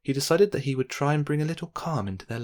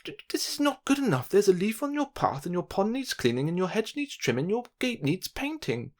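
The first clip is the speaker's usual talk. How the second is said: much higher, fussy scolding list; bossy voice; 9 semitones higher than usual. A narrator's reading. Mister Fussy listing faults in Mister Calm's garden, bossy. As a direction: nag like a prim busybody. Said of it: "a rather bossy voice".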